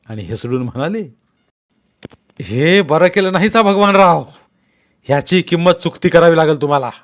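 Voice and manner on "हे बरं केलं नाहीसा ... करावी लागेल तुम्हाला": loud, higher grabbing-the-collar threat; enraged. Acted. Patil holding Bhagwanrao's collar, enraged threat. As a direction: threaten him through rage. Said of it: "Patil प्रचंड रागावले होते".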